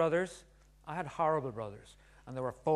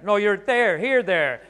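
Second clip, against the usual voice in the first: louder, exasperated insisting; exasperated dad; 6 semitones higher, 13 dB louder, slower than usual. Retold or imitated. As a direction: snap back at a kid who won't listen. Arguing with son about "there.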